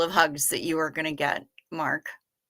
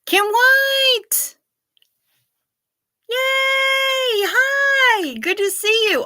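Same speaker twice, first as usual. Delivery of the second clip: high, loud squealing hello; excited greeting; 6 dB louder, slower than usual. Real, not acted. Streamer welcoming a viewer back, high excited shout.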